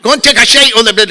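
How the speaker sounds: very loud, rough-and-ready Glaswegian caricature; mock broad Scots. Showing the talk they were used to, shouted Scottish caricature. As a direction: holler it in exaggerated thick Scottish. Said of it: "'cause we were used to".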